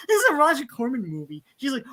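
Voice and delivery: ordinary talk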